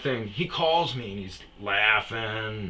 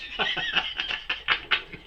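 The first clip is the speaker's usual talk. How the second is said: burst of laughter. Real, not acted.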